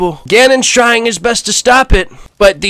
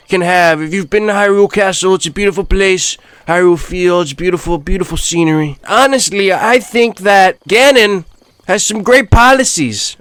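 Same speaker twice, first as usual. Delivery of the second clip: brash rally-speech Trump voice, boastful and rambling; Donald Trump impression. Trump touring Hyrule, boasting to the viewers in his voice. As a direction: play Trump bragging about Hyrule.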